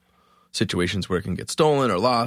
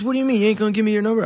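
indignant stranger; 12 semitones higher, 3 dB louder, much faster than usual. Retold or imitated.

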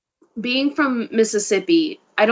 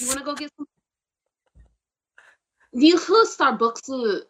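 higher-pitched imitated Cantonese accent; 5 semitones higher than usual. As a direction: mimic a Cantonese husband suggesting coffee. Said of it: "My husband's favorite word is Starbucks".

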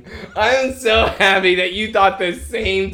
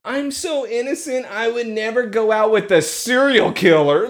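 mock innocent; 5 semitones higher, 3 dB louder than usual. Mocking Lana's innocence.

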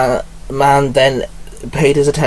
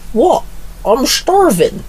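put-on much higher, slow teen voice; Alex with a mouthful; 13 semitones higher, slower than usual. A narrator's reading. Alex defends eating toast, mouth full, higher voice. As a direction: whine it through the toast. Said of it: "Alex said with a mouthful".

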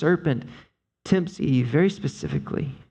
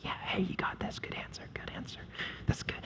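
whispered encouragement from the husband beside her; whispered husband cheering; 6 semitones higher, 17 dB quieter, faster than usual. Retold or imitated. Husband whispering support to his wife during the temptation. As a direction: hiss it like he's hiding behind her.

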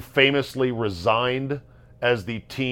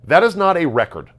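"that is not a record" higher and louder, weight on "is"; emphatic; 6 semitones higher, 6 dB louder than usual. Real, not acted. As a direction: hammer "is" in "that is not a record", falling hard. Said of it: "let me say this emphatically".

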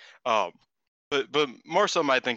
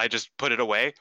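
ordinary delivery

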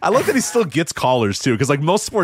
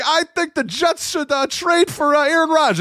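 dopey caller voice. Retold or imitated. Caller making his take.

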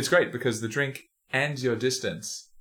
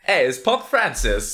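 loud, higher "Pop Francis" like a hype intro; announcer hype; 6 semitones higher, 8 dB louder, slower than usual. Pretend. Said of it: "love typos".

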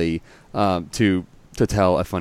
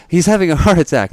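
panicked plea; 9 semitones higher, 6 dB louder than usual. Retold or imitated. Meme, woman in panic.

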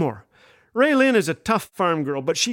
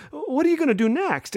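high, bright server voice; server's question; 9 semitones higher than usual. Retold or imitated. Server asking the family about plans, lightly voiced cheery. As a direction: ask like a cheery waitress.

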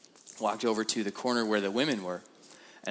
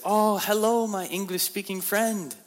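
high, loud, slow singsong greeting; cheery prisoner; 11 semitones higher, 5 dB louder, slower than usual. Retold or imitated. Prisoner at the window greeting the English-speaking visitor, high singsong. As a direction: call down sweetly in a foreign accent.

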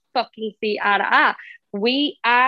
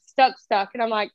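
ordinary talk, nothing marked

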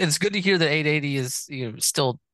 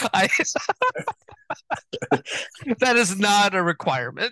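laughing; 6 semitones higher, 3 dB louder than usual. Real, not acted. Laughs at code switching remark.

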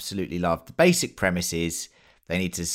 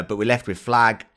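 normal talking voice

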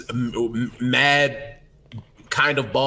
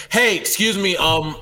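firm excuse me; 6 semitones higher, slower than usual. Shown as a demonstration. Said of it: "You gotta be like".

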